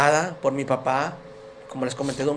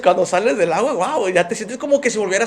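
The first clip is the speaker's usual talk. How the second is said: louder, lifting with joy; rising excitement; 4 semitones higher, 6 dB louder than usual. Real, not acted. Man telling the church about his baptism, growing excited. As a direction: let the wonder swell out.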